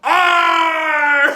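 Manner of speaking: loud, high shouted letter; gleeful pirate R; triumphant shout. Shown as a demonstration. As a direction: finish the chant with a roar. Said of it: "they spell".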